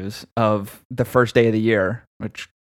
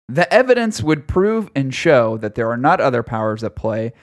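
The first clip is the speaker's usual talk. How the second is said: normal talking voice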